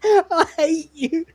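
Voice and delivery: high, mock-anguished teen whine; teenage angst. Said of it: "like a teenager, you know?".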